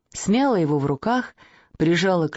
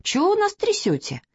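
put-on indignant little boy; 13 semitones higher than usual. Kostya child voice.

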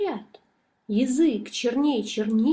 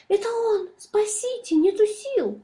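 very high frightened cry; panicked shriek; 11 semitones higher than usual. A narrator's reading. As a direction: squeal it out in terror. Fox flees in panic.

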